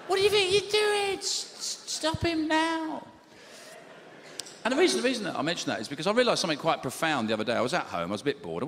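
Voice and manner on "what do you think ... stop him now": very high, panicked mock outcry; hammed-up high panic; alarmed outcry. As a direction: yelp high, then stammer and slow right down. Shocked outcry at the ad.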